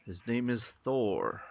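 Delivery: ordinary talk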